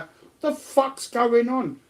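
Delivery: high, drawn-out disbelief; acting out slow, high-pitched bewilderment; bewildered outburst. Child thrown on toilet floor, bewildered. Retold reaction to sudden fall.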